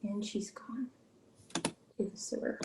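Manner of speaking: unvoiced, hushed aside; whisper. Real, not acted. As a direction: breathe it out as a secret.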